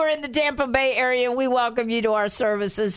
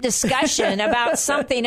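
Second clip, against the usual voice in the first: normal talking voice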